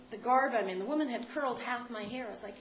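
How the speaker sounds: normal talking voice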